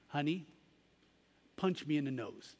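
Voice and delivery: put-on a mother kneeling to reassure her boy; soft tender mom, lightly voiced; gentle mother. Mother gently coaxes her son.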